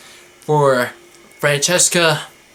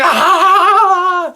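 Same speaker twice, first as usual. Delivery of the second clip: sudden high laughing yelp; high mock shriek with a laugh; mock startled yelp; 6 dB louder than usual. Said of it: "Roman Reigns is going like".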